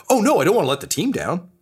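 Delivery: put-on high, fast fretting in a kid's voice; mock kid worry. Game master voices a kid afraid of failing the team, high worried voice.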